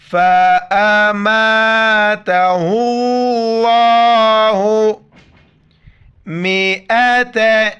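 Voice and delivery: melodic recited phrase, long final vowel; Quran recitation. Acted. Teacher reciting to students, melodic tilawat. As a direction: intone it as tilawat, with ringing vowels.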